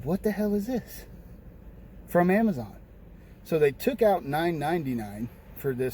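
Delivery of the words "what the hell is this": high, rising incredulous question; retold bewilderment. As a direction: replay baffled disbelief, pitched up. Retold reaction to short refund.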